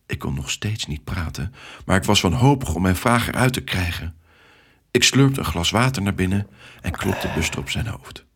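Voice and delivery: ordinary delivery, nothing marked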